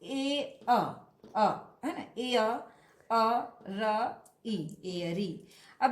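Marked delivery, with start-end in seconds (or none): 0.0-1.1 ए and अ as two distinct, held sounds; sounds separated. Shown as a demonstration. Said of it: "तो एयर". 3.1-4.8 अ, र, ई one at a time with pauses; sound by sound. Shown as a demonstration. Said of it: "ये आर का उच्चारण होगा".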